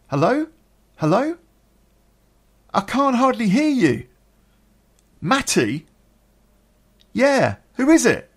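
high, loud, straining to be heard over noise; shouting into phone. Retold or imitated. Man answering phone in a noisy flat, shouting. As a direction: call down a bad line, acting it out.

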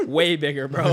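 amused retelling; laughing. Retold or imitated. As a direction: laugh as you say it. Laughing retold quote.

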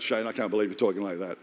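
a hint of a scolding wife; pastor's wife's look. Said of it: "She's giving me that".

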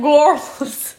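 mock cartoon villain accent; Gru. Quoting Despicable Me to a co-host, Gru impression. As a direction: round the vowel in a put-on accent. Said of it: "He's like".

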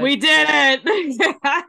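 loud, high, drawn-out celebration; triumphant cheer. Real, not acted. Host celebrating with co-host after the recap, triumphant cheer. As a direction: stretch it into a slow victory yell.

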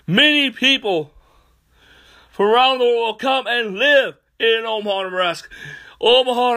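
high, loud fervent preacher voice; preaching at full force. Real, not acted. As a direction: proclaim it like a revival preacher.

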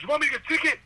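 high mock yell; retold yell. Shouting at the honking old man, high mock yell. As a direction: yell it up high at him, hammed up. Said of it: "And I yelled it four times".

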